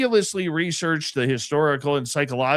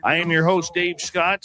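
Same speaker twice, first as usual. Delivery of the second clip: radio announcer; 5 dB louder than usual. Acted. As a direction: announce it like a late-night DJ. Radio show opening.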